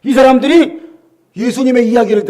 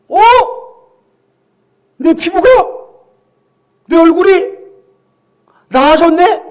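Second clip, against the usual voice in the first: amazed discovery; 6 semitones higher, 4 dB louder than usual. Retold or imitated. Lepers noticing they are healed.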